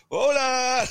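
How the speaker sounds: imitating the locals' loud, high cheer back; locals calling back. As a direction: boom it back like a whole pub raising glasses. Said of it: "they all lifted up the glasses to me and went".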